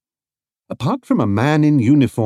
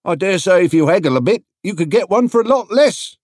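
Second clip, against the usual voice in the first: higher, louder trader voice; a chatty market trader, pitched up; another stallholder; 10 semitones higher, 5 dB louder, faster than usual. A narrator's reading. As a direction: stallholder urging him to haggle, higher. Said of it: "said another".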